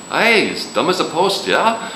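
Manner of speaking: Irish accent, friend mocking someone as dumb. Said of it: "He's Irish".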